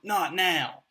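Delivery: loud high snapped "Not now" with a falling end; sharp snap. Retold or imitated. Father snapping "Not now" at his kids, loud and sharp. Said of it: "respond in a sharp tone".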